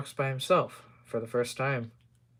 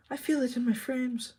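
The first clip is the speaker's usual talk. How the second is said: shrieking; 11 semitones higher than usual. Acted. Isabel the coach shrieking on the curve, mildly hammed. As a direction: shriek it as the train rounds the curve. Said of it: "she shrieked".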